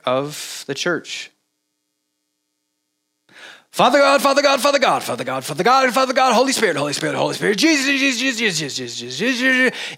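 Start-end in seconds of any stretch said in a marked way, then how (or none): 3.7-10.0 mock churchy chant of holy names; mock empty prayer. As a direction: parody a mindless repetitive prayer. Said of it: "this becomes the way that we pray".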